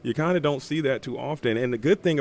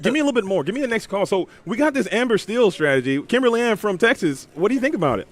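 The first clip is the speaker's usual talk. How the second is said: higher, louder call-in show host voice; cheesy talk radio host, louder and higher; 8 semitones higher, 3 dB louder than usual. Pretend. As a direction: hype it like a radio host taking the next call. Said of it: "mock radio call-in host".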